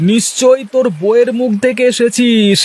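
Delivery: laughing through a tease about the wife; chuckle, tease, chuckle again; teasing laugh. Acted. Teasing friend about his wife. (হাসতে হাসতে বন্ধুকে খোঁচা)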